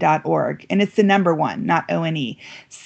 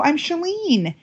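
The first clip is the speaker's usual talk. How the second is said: high, bright-eyed hello; eager introduction; 10 semitones higher, slower than usual. Retold or imitated. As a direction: introduce yourself, thrilled. Retold introduction to girl.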